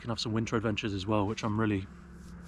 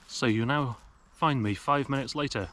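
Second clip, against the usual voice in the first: ordinary talk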